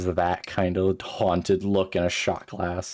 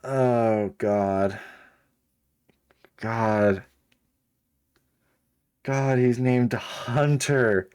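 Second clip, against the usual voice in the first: exasperated disbelief; 3 semitones higher, much slower than usual. Real, not acted. Reacting in disbelief to the name.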